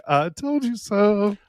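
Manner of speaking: drawn-out, high-pitched told-you-so; Michael gloating. Retold or imitated. Co-host imitating Michael reacting to the news, gloating. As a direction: stretch it out high in a mocking sing-song. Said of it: "look at Michael's like".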